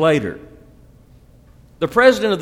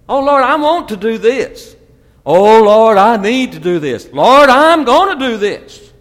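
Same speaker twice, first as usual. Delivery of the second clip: much louder, higher begging to God; hammed-up demanding prayer, building; mock whiny prayer; 7 semitones higher, 9 dB louder than usual. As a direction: plead like a spoiled child asking the Lord.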